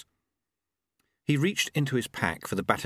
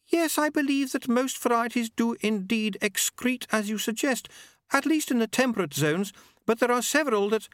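high, prim and polite; a higher, refined lady's voice; Lady Lejeune voice. A narrator's reading. Lady Lejeune politely answering Susan, in a higher refined voice.

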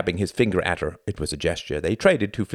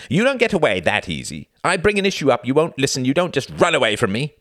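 heated, raised-pitch scolding; angry; 9 semitones higher, 4 dB louder than usual. Acted. Husband follows wife who ran from argument, angry. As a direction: chase her down with accusations.

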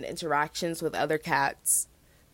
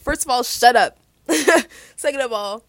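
loud mock retort; playful shut up; 8 semitones higher, 11 dB louder than usual. Real, not acted. Answering the imagined scolding listener with a loud mock retort. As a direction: snap back at them, laughing it off.

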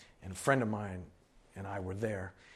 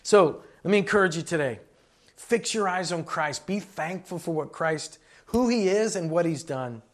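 loud, raised-pitch preaching; emphatic, rousing sermon close; preaching exhortation; 4 semitones higher, 7 dB louder than usual. Real, not acted. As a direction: urge the congregation on with force.

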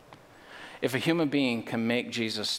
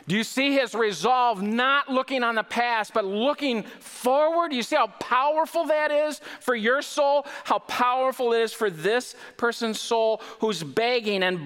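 much higher and louder, fervent; preaching at full force; 10 semitones higher, 3 dB louder than usual. Acted. Pastor urges his listeners about their soul, fervent and high. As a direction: lift them with the blind man's resolve.

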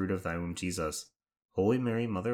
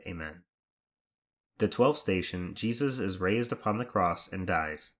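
usual speaking voice

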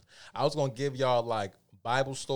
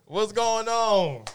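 excited greeting; 12 semitones higher, 11 dB louder, much slower than usual. Real, not acted. Greeting the guest.

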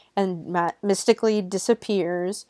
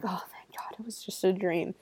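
breathy whispered relief, almost silent; whispered "oh thank God" in relief; relieved whisper; 9 dB quieter than usual. Retold or imitated. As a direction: whisper it like the nightmare just ended. Viewer relieved after nightmare.

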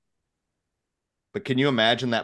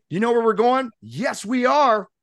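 fake confident leader; 5 dB louder than usual. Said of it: "turn around and say".

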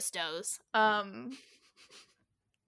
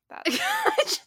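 squeak; 5 dB louder than usual. Pretend. Squeaked playful remark.

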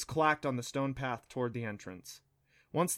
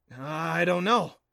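slow, drawn-out, unsure admission; higher, louder, uncertain drawl; hesitant admission; 4 semitones higher, 5 dB louder, much slower than usual. A narrator's reading. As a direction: stretch it out as you own up. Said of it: "Neff admitted".

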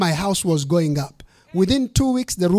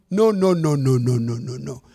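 firm refusal fading; 4 semitones lower than usual. Retold or imitated. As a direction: shake it off, fading to nothing. Refusing the contract demand.

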